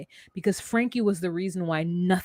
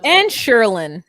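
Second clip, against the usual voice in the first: mock announcer holler; 8 dB louder than usual. Co-host hollers out the name.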